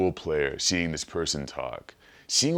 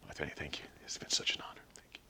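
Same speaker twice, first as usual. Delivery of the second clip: awed whisper of thanks; 4 semitones lower, 16 dB quieter, faster than usual. Pretend. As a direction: breathe it out, starstruck. Starstruck fan being insulted.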